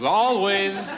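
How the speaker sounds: "always" high and drawn out; emphatic correction. Acted. Comic correcting a guru's maxim, "always" high, slow and emphatic. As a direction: land hard on "always", stretching it. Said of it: "he turned to me".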